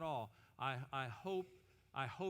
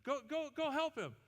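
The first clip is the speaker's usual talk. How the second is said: alarmed foreman; 9 semitones higher than usual. Acted. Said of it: "The foreman looks and he goes".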